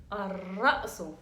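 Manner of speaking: Ar-ra'su drawn out slowly, the r doubled; slow model word. Shown as a demonstration. Teacher models the word slowly for students to repeat. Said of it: "Ra. Elle est doublée".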